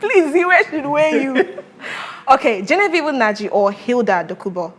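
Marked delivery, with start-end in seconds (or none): 0.0-1.7 slow, high words through laughter; real playful laughter; teasing laugh. Real, not acted. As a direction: tease him while laughing. Teasing guest about the actress.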